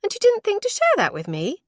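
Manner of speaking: irritated. A narrator's reading. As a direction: let the annoyance burst out. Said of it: "incapable of hiding her irritation".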